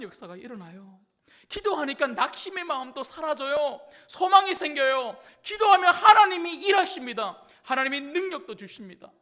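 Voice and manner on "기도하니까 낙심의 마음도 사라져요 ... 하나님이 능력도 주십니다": loud, raised, declarative preaching; full-force preaching. Real, not acted. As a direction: proclaim it forcefully to the congregation. (힘차게 외치며 선포하는 설교)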